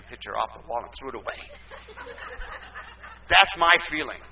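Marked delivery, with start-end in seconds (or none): 1.4-3.3 breathy laugh. Real, not acted. Laughs at own remark.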